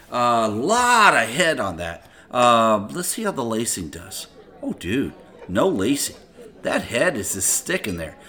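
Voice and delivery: usual speaking voice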